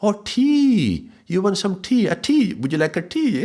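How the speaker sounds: the shopkeeper finally understands; shopkeeper getting it. Retold or imitated. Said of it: "something clicked".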